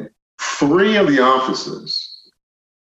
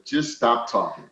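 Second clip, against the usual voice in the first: calming retold request; 3 semitones lower, 7 dB quieter than usual. Someone calms him in retelling.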